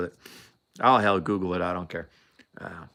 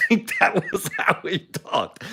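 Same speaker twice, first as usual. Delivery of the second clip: higher, slowed by real laughter; laughing; 8 semitones higher, slower than usual. Real, not acted. Storyteller amused by the Scotsman's way of talking, laughing. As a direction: laugh through the words. Cracking up at his own story.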